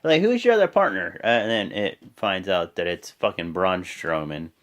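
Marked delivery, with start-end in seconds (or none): 0.0-1.2 higher-pitched acted objection; Xavier Woods. Objecting that Lana doesn't count, lightly imitated protest. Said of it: "Xavier Woods is like".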